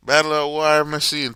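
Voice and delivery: loud hype yell. Real, not acted.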